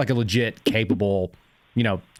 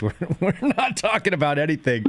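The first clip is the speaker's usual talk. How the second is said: higher voice, amused through the words; laughing retold refusal; 6 semitones higher than usual. Officer refusing a suspect who invoked, amused and laughing. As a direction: retell the brush-off through a chuckle.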